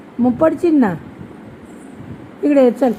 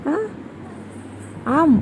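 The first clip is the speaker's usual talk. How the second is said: ordinary talk, nothing marked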